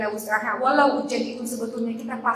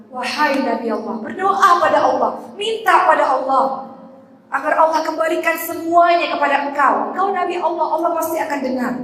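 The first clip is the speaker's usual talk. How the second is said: higher, louder desperate wife begging her husband; pleading wife; 6 semitones higher, 7 dB louder than usual. Acted. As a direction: urge him to pray, half in despair. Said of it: "Sampai istrinya berkata". (istri yang memohon dengan suara tinggi dan keras)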